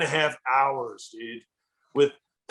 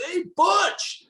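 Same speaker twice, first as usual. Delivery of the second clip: sudden high call of a name across the room; mock-yell his name to get attention, pitched way up; retold yell; 13 semitones higher than usual. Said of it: "he yells my name".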